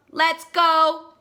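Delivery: high, loud robot shout; excited shout. Acted. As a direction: shout it, ready to zoom off. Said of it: "Robo Pete shouts".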